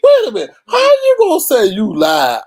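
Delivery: high, loud shout of disbelief; outraged shout. Real, not acted. Vlogger shouting in disbelief at the clip's confession. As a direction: yell it out in shock.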